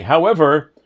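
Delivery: ordinary talk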